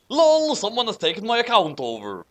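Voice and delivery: a hammed-up author voice; author's note voice. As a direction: read it as the clueless fic author. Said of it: "that voice is kind of hard to do".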